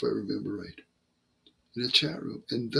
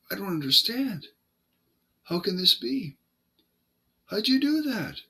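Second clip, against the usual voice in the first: pitched up, rising into disbelief; baffled Satanist; 7 semitones higher than usual. Retold or imitated. Satanist asking the preacher how he did it, high and baffled. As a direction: voice the stranger's rising bewilderment.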